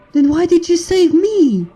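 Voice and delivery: put-on high, pointed young mare's question. A narrator's reading. Sunrise challenging Steam Lord, high indignant voice.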